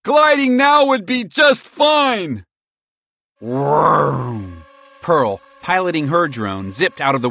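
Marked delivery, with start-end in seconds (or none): 0.0-2.4 high, slow, loud wail; despairing high squirrel cry. A narrator's reading. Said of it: "Merle cried". 3.4-4.6 drawn-out voiced vroom; imitated engine vroom. A narrator's reading. Narrator imitates a drone taking off.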